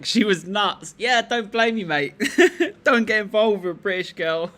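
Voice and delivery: laughing it off, loud and high. Real, not acted. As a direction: joke through the laughter. Laughs about his ex.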